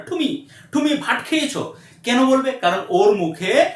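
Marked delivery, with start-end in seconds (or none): 0.1-1.7 high mock foreign accent in Bengali; foreigner's accent. Foreign learner who can't say tumi properly, foreign accent. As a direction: stumble through Bengali as a foreigner would. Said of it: "তুমি বলবে না".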